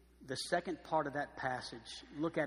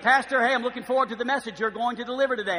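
eager churchgoer; 9 semitones higher, 14 dB louder, faster than usual. Retold or imitated. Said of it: "Oh, and I get it".

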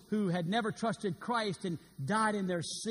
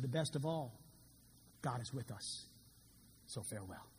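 lower, softer dying voice; faint farewell; 5 semitones lower, 9 dB quieter than usual. Acted. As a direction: fade away peacefully. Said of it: "John Wesley said".

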